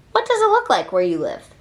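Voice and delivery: usual speaking voice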